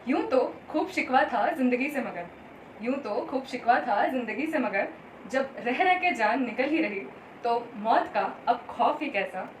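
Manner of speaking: measured Hindi verse with lilting phrases; poetry recitation. Acted. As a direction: recite it line by line, pausing between lines. Recites her Hindi poem.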